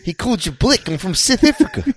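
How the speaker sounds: put-on high, hammy South African accent; mock South African accent. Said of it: "scrunched up Australian accent".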